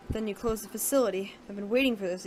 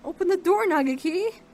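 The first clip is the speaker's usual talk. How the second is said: desperate shout; 7 semitones higher, 6 dB louder than usual. Acted. Locked out, pleading with friend.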